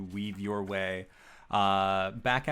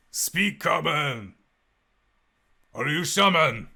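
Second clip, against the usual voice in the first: lizard folk guard; 7 semitones higher, 7 dB louder than usual. Acted. Lizard guard to captured intruders, loud demanding creature voice. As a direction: challenge the strangers as a lizard guard, loudly. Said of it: "one of them, uh, ends up approaching".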